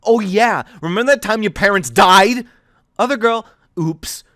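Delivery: lightly voiced girl, mock-cheerful and cutting; sarcastic mockery. Thea mocking Aiden's plan, sarcastic and bitter. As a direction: play it like a cruel game show host.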